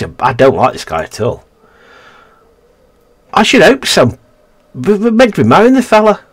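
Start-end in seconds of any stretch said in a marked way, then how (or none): 3.3-6.2 high, mock-outraged exclamation; hammed-up incredulous protest; mock indignant. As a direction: squeak in put-on disbelief.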